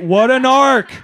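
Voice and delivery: comic shouting the punchline to the audience; loud exclamation. Real, not acted. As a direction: bellow it at the crowd.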